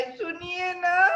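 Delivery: high slow wailing call; wife calling out. Acted. Wife wailing again while husband kneads dough. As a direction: drag out the call. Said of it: "फिर चिल्लाई".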